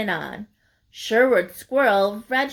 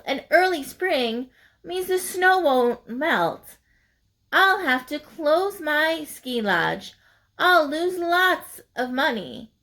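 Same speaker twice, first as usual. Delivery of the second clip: slow, higher grumbling raccoon voice; grumpy raccoon; 6 semitones higher than usual. Acted. Raccoon grumbling to himself behind tree, slow higher grumble. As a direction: grumble slowly about lost money.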